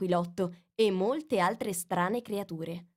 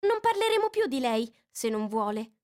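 Alice voice; 6 semitones higher, 3 dB louder than usual. A narrator's reading. Alice puzzles over the mouse. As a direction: wonder to yourself.

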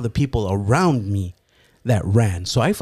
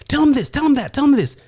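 much higher, urgent rapid commands; frantic mom orders; faster than usual. Retold or imitated. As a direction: drill him like a panicked mother. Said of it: "things that my mom was saying".

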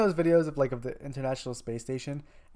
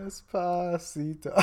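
slow, stretched-out word; drawn-out; 5 semitones higher, much slower than usual. Pretend. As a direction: drag each syllable out. Drawn-out song title.

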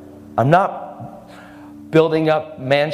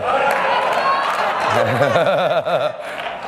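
long helpless real laugh. Real, not acted. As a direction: crack up and keep laughing. Cracking up at own self-censoring.

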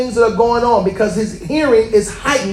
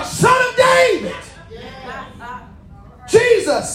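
high, shouted plea; cry out; 10 semitones higher than usual. Shown as a demonstration. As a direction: cry out to Jesus at full voice. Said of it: "you gotta cry".

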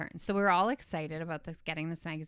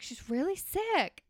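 mock insistent boyfriend; 10 semitones higher, slower than usual. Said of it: "He's like".

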